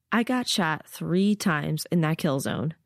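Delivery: ordinary delivery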